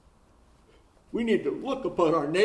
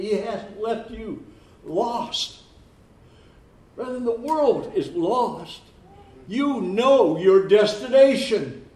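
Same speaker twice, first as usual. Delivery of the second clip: higher, slower, swelling preacher cry; preaching climax; 6 semitones higher, 9 dB louder, slower than usual. Acted. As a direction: lift them up with hope. Sermon climax building.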